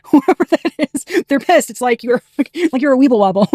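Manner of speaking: giggling high through the words; bubbling laughter, voice jumping up; laughing. Real, not acted. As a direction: laugh as you tease them. Teasing whoever is pissed off.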